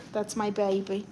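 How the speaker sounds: ordinary talk, nothing marked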